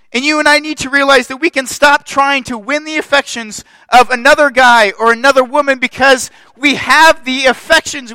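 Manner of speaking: loud, high preaching build. Acted. Pastor preaching loud to his church. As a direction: preach it out to the congregation.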